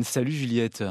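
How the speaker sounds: ordinary delivery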